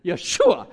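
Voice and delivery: Yeshua blurted loud, like a sneeze. Shown as a demonstration. Pastor joking the name sounds like a sneeze, loud. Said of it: "Kinda sounds like you're sneezing".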